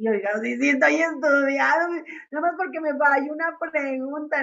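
flustered, defensive student voice. Retold or imitated. Student caught out in front of the patient, flustered mock excuse. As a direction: play the embarrassed student excusing herself. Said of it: "y tú así enfrente del paciente como diciendo".

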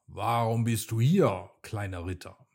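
usual speaking voice

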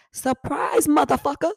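loud, high gloating taunt; taunting surprise. Retold or imitated. Finally confronting the girl.

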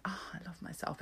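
a soft breathy whisper, almost to herself; whispered self-love. Retold or imitated. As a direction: whisper it as a private little boast. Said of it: "sometimes I'm like".

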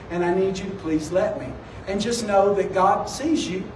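low, soft, reassuring appeal; gentle explanation. Retold or imitated. Pastor offering to pay for a stranger's cart, gentle. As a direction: softly ask her to let you help.

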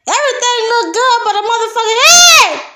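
high, loud indignant cry; outraged exclamation. Real, not acted. Ranting about a coworker, cries out loudly. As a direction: exclaim it in disbelief.

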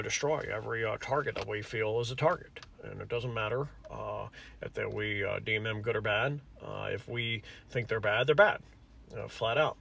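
lightly voiced Dick Cheney, low and quiet; Cheney impression.

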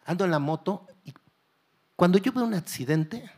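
ordinary delivery

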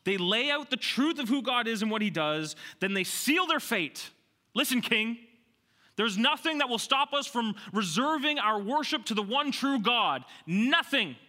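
loud, high, defiant sermon voice; full-force preaching. Real, not acted. Preacher voicing the men's refusal to the king, full-force preaching. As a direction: hammer it out to a punched final word.

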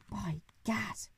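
hushed startled gasp of words; startled exclamation. A narrator's reading. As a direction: blurt it out in shock. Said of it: "cried the Duke".

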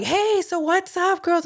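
high, drawn-out cheery hello; breezy greeting. Retold or imitated. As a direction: re-enact a carefree, sing-out greeting. Breezy greeting to worried sisters.

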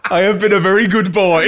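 high, loud, giggling echo; gleeful mock boasting; laughing mock self-praise. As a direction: parody the proud chatbot while laughing. Joking echo amid laughter.

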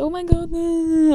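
high, drawn-out mock swooning; gushing fake admirer. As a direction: gush like a guy faking adoration. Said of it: "am Anfang kann jeder so tun".

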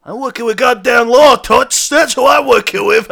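loud, high-pitched indignant outburst; hammed-up angry detective; indignant shout. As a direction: throw the accusation back at full volume. Detective answers accusation angrily.

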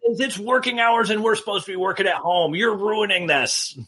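cranky neighbor yelling at workers; grumpy old man. Pretend. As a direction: scold them like an irritated old man. Yelling at noisy construction workers.

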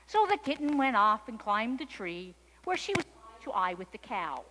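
plain reading voice

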